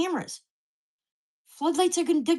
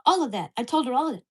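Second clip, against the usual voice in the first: normal talking voice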